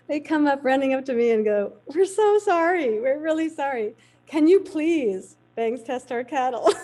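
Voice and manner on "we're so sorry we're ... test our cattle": mock pleading cowboys. Cowboys begging after chasing her truck.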